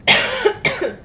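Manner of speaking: high fake cough; a put-on cough. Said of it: "I literally just acted on a cough".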